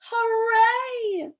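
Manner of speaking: high, bright hooray; excited cheer. Acted. Little girl running to school, cheering high. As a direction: cheer like a happy child off to school. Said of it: "said Chrysanthemum".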